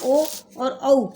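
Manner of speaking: slow ओ and औ, each vowel held apart; contrasting vowels. Shown as a demonstration. Teacher showing children the ओ and औ difference, slow and clear. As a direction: say ओ and औ slowly so the difference shows. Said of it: "बोलते हैं ना तो पता लगता है ना".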